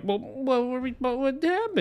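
high, far-off mock bewilderment; mock surprised alien. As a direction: play dumb in a high put-on voice. Mocking the Na'vi's feigned surprise.

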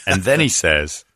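ordinary talk

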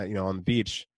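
ordinary delivery

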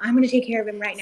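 eager hurry to fix it; fast, hurried manager. Retold or imitated. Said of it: "and she's like".

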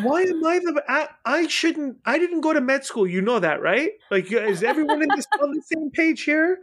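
high-pitched, flustered retort; incredulous protest. Retold or imitated. Patient telling others they never went to med school, incredulous. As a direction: protest in disbelief, voice jumping up. Retells own incredulous reply to doctor.

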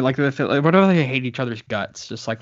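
usual speaking voice

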